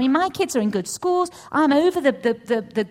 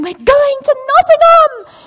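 much higher, louder, slow excited yell; elated shout; 15 dB louder than usual. Retold or imitated. Wife yelling the news at her husband, elated loud slow shout.

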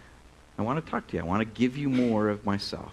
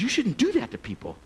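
mild mock anger; 10 semitones higher than usual. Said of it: "she was really mad".

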